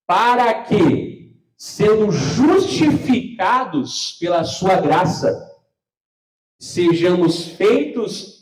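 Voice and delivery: very slow, loud, drawn-out recitation; solemn scripture reading. Acted. Pastor reads verse seven to the congregation, very slow and loud. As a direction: stretch every word like a proclamation from the pulpit.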